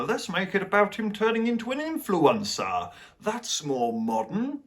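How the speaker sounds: mock executive pitch. Parodying studio executives' pitch.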